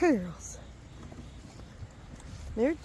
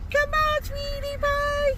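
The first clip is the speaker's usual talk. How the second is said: coaxing pet call; 12 semitones higher than usual. Real, not acted. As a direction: coo it up high and stretch it out. Calling a pet over.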